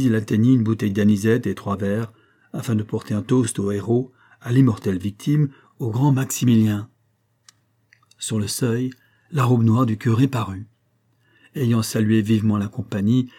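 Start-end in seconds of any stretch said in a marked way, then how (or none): none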